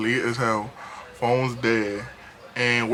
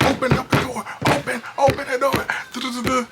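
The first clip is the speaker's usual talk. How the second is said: high, urgent calling; mock pleading yell; 10 semitones higher than usual. Man outside banging, calling high to open up. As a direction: shout through the door to get in. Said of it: "He's banging on the door like".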